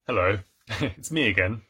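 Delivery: ordinary talk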